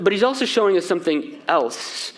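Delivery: ordinary talk, nothing marked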